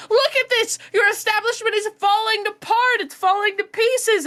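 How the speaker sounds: high, loud outraged customer; loud, high, theatrical outrage; indignant shout. Acted. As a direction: shout it like a scandalized widow. Player character berating staff.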